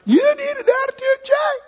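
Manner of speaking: very high, loud squeaky coach; pep talk coach voice. Retold or imitated. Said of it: "Every time he'd give us the pep talk".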